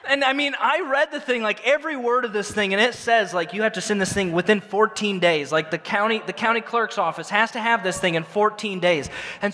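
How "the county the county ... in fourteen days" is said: ordinary talk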